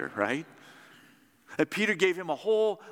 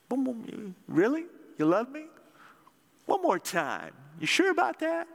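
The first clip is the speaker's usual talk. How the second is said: higher, needling and playful; mock teasing; 8 semitones higher than usual. Shown as a demonstration. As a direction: rib him like a joking friend. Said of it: "Is he messing with Peter?".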